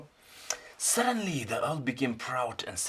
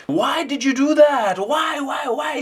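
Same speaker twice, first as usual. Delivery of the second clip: much higher and louder anguished yell; shouting raven; 12 dB louder than usual. Acted. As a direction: scream at the friend who betrayed you. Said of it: "shouted the raven".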